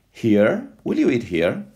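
ordinary delivery

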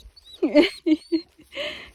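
loud delighted giggle; high laugh. Real, not acted. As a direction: burst into happy laughter. Laughing at the dog.

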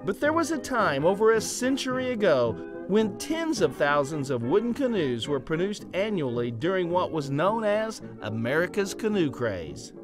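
usual speaking voice

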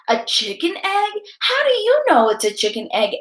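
puzzled question, high then quickly rattled off. A narrator's reading. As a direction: query EGG, then ask quickly. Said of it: "asked Jessica".

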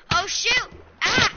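sudden alarmed yelp; panicked yelp. Real, not acted. Golem attacks him.

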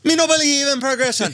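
mock Jamaican.

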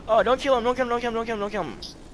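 high, rapid-fire urgent pleading; urgent plea. Real, not acted. Player stopping his teammate from killing, urgent plea.

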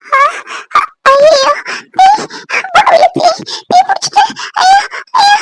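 high, gasping panic; frightened cries. Acted. Woman discovers her sari burning, terrified. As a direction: cry out in short broken bursts. Her clothing has caught fire.

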